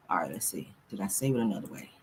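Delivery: whispered to herself; hushed aside. Real, not acted. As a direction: breathe it while searching. Searching for clip muttering.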